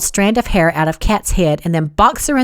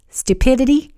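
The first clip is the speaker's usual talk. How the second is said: higher, sheepish guess; wincing; 6 semitones higher than usual. A narrator's reading. Wyatt explaining his old romance, wincing. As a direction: offer it as a questioning confession. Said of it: "he winced".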